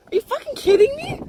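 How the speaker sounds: high, loud disbelieving cry; incredulous outburst. Real, not acted. Ranting to viewers about wasted money, high incredulous outburst. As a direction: throw the question up in outrage. Outrage at spending.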